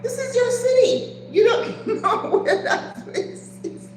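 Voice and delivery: high-pitched protest dissolving into laughter; laughing disbelief. Retold or imitated. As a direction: protest incredulously, cracking up as you go. Mock protest at clueless cab drivers.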